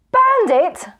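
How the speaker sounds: high, loud disbelieving cry; shocked cry. A narrator's reading. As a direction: shriek the words in horror. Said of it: "cried Zuckelmann".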